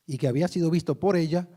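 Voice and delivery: ordinary talk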